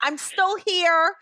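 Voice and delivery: drawn-out cat insisting it's still around; cat's voice. Retold or imitated. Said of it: "Cat's like".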